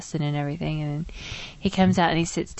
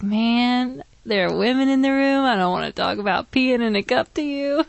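put-on embarrassed young guy; 6 semitones higher than usual. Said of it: "just shaking his head like".

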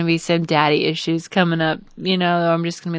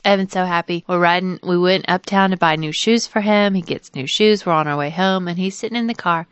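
ordinary talk

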